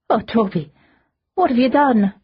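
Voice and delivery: on the edge of tears; anguished, barely holding back a sob; choked, near crying. A narrator's reading. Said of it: "between choking and crying".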